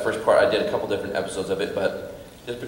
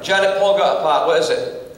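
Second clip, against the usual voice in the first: higher, louder Scottish shout to the wife; Scottish dad calling out; 9 semitones higher, 6 dB louder than usual. Retold or imitated. Father calling to wife Janet, eager Scottish voice. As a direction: call over to Janet, eager.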